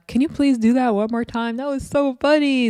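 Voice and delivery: high, gushing mock request; Natalie. Host mimics Natalie asking for a repeat, high mocking voice. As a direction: imitate an amused fan begging for an encore. Voicing Natalie begging for an encore.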